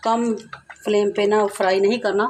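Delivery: ordinary talk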